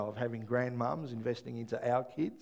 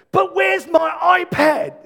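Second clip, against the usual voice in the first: yelling across the room; 18 dB louder, much slower than usual. Retold or imitated. Child calling mum from bed.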